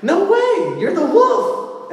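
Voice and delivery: very high, loud mock-indignant squeal; hammed-up high-pitched refusal. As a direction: squeal it out like an alarmed storybook girl. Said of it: "then she's like".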